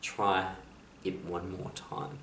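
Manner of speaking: soft whisper. Acted. Inspirational quote, hope answering the world, hushed whisper. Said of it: "hope whispers".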